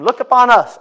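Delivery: high, loud call to look up; booming apostolic call; apostles' command. Acted. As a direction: command the beggar's attention. Apostles voiced in story.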